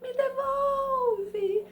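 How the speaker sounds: high, slow, weeping plea; crying ghost woman. Retold or imitated. Ghost woman begging in the bedroom, high slow crying wail. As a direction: wail it like a lost spirit begging. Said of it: "e ela diz assim, mais ou menos assim".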